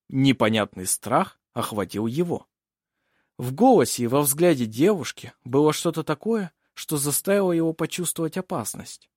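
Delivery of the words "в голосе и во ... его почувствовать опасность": ordinary delivery, nothing marked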